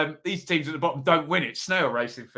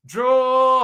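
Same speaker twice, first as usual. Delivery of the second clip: excited shout; 9 semitones higher, 8 dB louder than usual. Real, not acted. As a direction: draw called out high and loud. Excited reveal of prediction.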